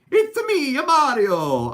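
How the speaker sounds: high, loud cartoon plumber cry; Mario impression. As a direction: belt it out like Mario jumping in. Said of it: "I was doing a Mario impression".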